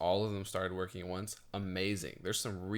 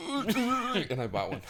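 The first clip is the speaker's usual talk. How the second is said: excited gasp; 13 semitones higher, 6 dB louder, slower than usual. Retold or imitated. Gasp while telling of buying.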